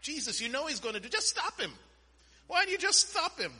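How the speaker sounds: high-pitched, pleading protest to Jesus; exasperated plea. Retold or imitated. Preacher recalls questioning Jesus as a youth, exasperated plea. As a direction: protest it like a baffled youngster, pitch jumping up. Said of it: "when I was growing up".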